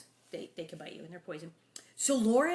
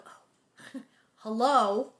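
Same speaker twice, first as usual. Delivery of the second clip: dawning realization; 8 dB louder, much slower than usual. Retold or imitated. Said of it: "you go".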